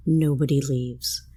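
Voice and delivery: ordinary delivery, nothing marked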